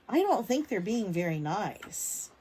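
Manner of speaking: plain reading voice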